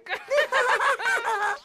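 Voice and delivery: carcajada aguda y malvada; ríete como un estafador satisfecho; risa malvada. Acted. Ted ríe tras su plan. (risa malvada aguda)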